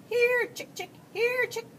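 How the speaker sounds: high, loud chicken call; yelling. A narrator's reading. Junior copies the farmer's feeding call, yelling high and loud. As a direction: yell high to call the hens over. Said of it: "Junior took the bucket and yelled".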